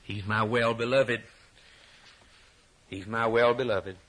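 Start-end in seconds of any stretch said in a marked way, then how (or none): none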